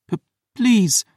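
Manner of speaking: high, stammered plea; begging. Acted. Wounded Phoebe begs while holding a wrist, pleading. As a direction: beg weakly through the pain. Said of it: "Phoebe begged".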